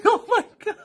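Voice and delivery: high, helpless laughing exclamation. Retold or imitated. Reacting to her friend's blunt joke, high laughing shock. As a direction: squeal it through laughter.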